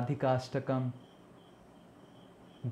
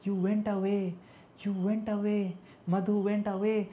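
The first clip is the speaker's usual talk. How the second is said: Radharani lamenting; 7 semitones higher, 3 dB louder, faster than usual. Acted. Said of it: "Radharani says".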